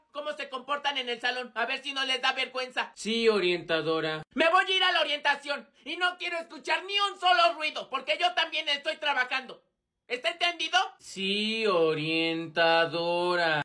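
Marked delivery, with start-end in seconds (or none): 3.0-4.2 much lower, mumbled student voice; sullen student. Acted. Alumno regañado contesta a la orientadora. 11.0-13.6 much lower, very slow drawn-out reply; sullen student. Acted. Alumno regañado contesta a la orientadora.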